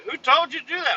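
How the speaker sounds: high mocking boss voice. Boss demanding who gave the order, high mock voice. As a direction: squeak it like a fussy boss. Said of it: "He's like".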